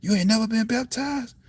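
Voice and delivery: put-on high, startled disbelief from the old lady; retelling the old lady's high shocked question. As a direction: gasp it out like she can't believe it. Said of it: "And she said".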